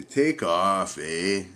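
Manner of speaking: put-on quoting the comedians in a light Canadian accent; Canadian catchphrase. Vlogger quoting Bob and Doug to viewers, light Canadian accent. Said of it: "they'd always say".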